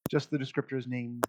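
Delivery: ordinary delivery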